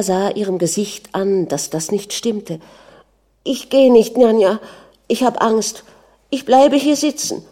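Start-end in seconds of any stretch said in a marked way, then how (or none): 3.4-7.4 put-on high-pitched, insistent child; frightened little girl. A narrator's reading. Manja refuses to leave her mother, frightened child. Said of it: "sagte das Mädchen".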